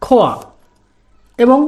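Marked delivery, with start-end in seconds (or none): 0.0-0.3 খোয়া said carefully, kh gliding into wa; demonstrated pronunciation. Shown as a demonstration. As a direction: model খোয়া on its own for learners. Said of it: "আমাদের উচ্চারণটি দাঁড়াবে".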